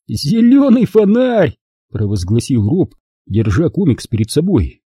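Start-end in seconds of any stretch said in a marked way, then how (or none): 0.1-1.6 slow, high, theatrical announcement; slow theatrical mockery. A narrator's reading. Rob holding up the comic to mock Max, slow and theatrical. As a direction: proclaim it like a mocking showman. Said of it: "Голос его изменился, стал более неторопливым, чем обычно, более театральным".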